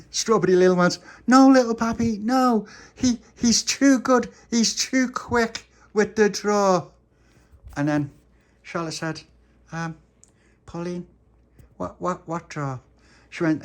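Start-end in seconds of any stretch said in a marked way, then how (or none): 1.3-6.9 very high, slow, pleading little voice; squeaky toy voice begging; high pleading character voice. Acted. 10.6-12.8 high, quieter, puzzled little voice; hushed little doll question; soft high character voice. Acted.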